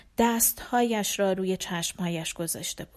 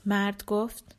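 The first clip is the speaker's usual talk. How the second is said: ordinary delivery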